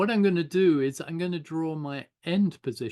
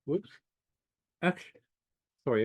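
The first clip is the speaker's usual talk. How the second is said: talking around a pen in the mouth; faster than usual. Real, not acted. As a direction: speak with a pen between the teeth. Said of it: "I got the pen in my mouth".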